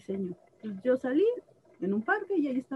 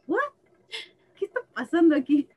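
higher and louder, baffled disbelief; retold shock; 8 semitones higher, 6 dB louder than usual. Tattooed student recalls the lady storming off, baffled. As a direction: blurt it out like you can't believe it. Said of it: "Y yo así de".

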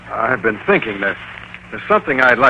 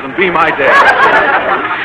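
flustered laugh; 4 semitones higher, 5 dB louder, much slower than usual. Acted. Boynton flustered asking her out.

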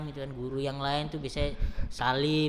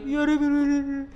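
loud, very high mock-tearful wail; imitated crying; 9 semitones higher, 9 dB louder than usual. Retelling the tearful call between departing friend and mates, imitated crying. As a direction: play a tearful, pleading phone call at full volume. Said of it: "Terus mereka juga nangis".

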